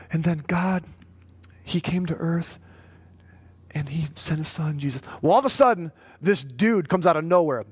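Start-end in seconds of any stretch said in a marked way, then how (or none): 0.1-5.0 soft, timid, near-whispered telling; imitating meek voice. Pastor imitating Chandra telling the creation story, timid hush. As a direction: mimic a shy woman gently sharing. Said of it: "she talks like this".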